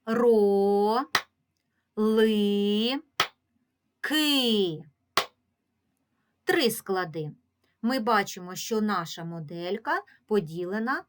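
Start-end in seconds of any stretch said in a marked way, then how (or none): 0.0-4.8 Ро, ли, ки each held and separated by a long pause; slow syllable-by-syllable demo; split into syllables. Shown as a demonstration. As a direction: break it into Ро - ли - ки, one beat each. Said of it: "Поділимо це слово на склади".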